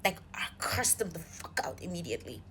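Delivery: low, whispery emphasis; hushed, intense confiding; emphatic hush. Real, not acted. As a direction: drop to a near-whisper to stress how serious it was.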